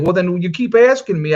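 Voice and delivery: retold pushback with a touch of exasperation; mildly exasperated retort. Dad answering a stranger who keeps asking, mildly exasperated.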